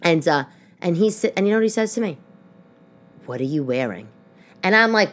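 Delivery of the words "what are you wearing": low, quiet disdainful question. Retold or imitated. Ex-husband to his dressed-up wife, quiet disdain. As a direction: put soft, withering disdain into it. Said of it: "That immediate sound of disdain to his voice".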